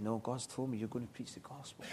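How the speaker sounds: hushed certainty, gently insisting; soft insistent murmur. Retold or imitated. Mother at bedside quietly insisting, soft and certain. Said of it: "And she said".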